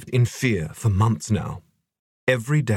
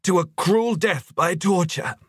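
high, anguished plea; choked appeal; 8 semitones higher than usual. A narrator's reading. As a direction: choke out an appalled question, rising in pitch. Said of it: "choked Idless".

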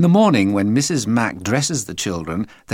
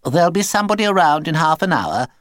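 voice on the phone; 7 semitones higher, 4 dB louder, faster than usual. A narrator's reading. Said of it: "said the voice".

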